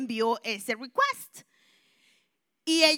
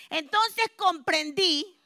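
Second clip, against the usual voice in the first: preaching; 5 semitones higher, 6 dB louder than usual. Real, not acted. To the church, testifying about God's purpose, rising preaching fervor. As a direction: proclaim the lesson to the congregation.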